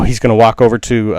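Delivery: normal talking voice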